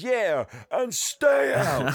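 high, loud, drawn-out mock yell; hammed-up send-off shouted after someone; triumphant shout. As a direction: stretch the words into a big yell. Throws his hat on the ground.

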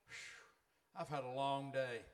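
a hint of an exhausted churchgoer; tired flesh voice. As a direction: play the worn-out body begging off. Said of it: "This thing called flesh".

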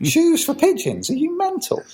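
very high mock incredulity; playful scoffing in a high voice; mickey-taking disbelief. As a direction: pitch it way up, ribbing a mate's silly idea. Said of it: "we will take the mickey".

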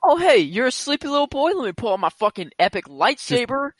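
high-pitched sing-song villain mockery; mock condescension. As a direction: show off to a baby with exaggerated sweetness. Mockingly voicing villain flexing on baby.

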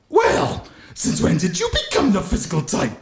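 high-pitched movie bully impression; Griff Tannen. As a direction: put on a high, taunting villain voice. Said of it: "That was Griff Tannen".

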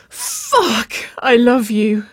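very high, loud cry. A narrator's reading. Waverley declaring love to Nicole, crying out high. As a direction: cry it out, overcome. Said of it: "Waverley cried".

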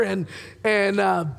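normal talking voice

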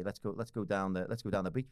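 normal talking voice

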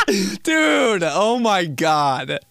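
high, loud laugh into dude; laughing hard. Real, not acted. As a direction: crack up and gasp the words out. Cracking up.

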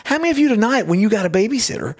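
high-pitched stand-up delivery, imitated; retold comedian. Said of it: "he's a comedian. He goes".

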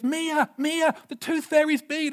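put-on a boy calling his sister; excited brother calling his sister's name; calling out excitedly. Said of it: "comes to the top of the stairs".

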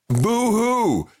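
sarcastic boo-hoo, drawn out; mock crying. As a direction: pretend to sob, tongue in cheek. Sarcastic about not affording private jets.

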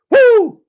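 high, loud whoop of joy; joyous woo. Real, not acted. Pastor whooping at the peak of his sermon, loud cry. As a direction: let out a big whoop.

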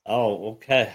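ordinary talk, nothing marked

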